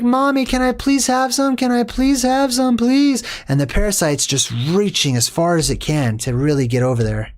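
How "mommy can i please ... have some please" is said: put-on high, pleading child's voice; whiny kid. A kid begging his mom for a burger, high and whiny. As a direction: beg like a kid mid-tantrum. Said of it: "And he's like".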